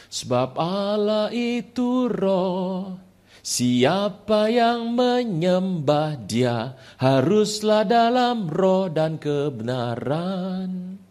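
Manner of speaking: very slow, solemn verse recital; slow reverent Bible reading; scripture recitation. Acted. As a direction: intone the verse as if it rises from within. Verse surfacing in his mind.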